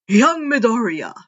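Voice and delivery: booming call. Acted. All Might calling to Izuku, loud and hearty. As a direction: call out heartily across the hall. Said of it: "All Might called".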